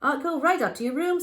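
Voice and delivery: high loud hasty clerk; suddenly eager to please; nervous hotel clerk. Acted. Scared hotel man gives in.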